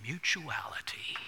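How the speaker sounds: put-on hushed, distinguished British accent; a soft touch of British gravity. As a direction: lightly imitate a distinguished British speaker, softly. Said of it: "In his distinguished British accent".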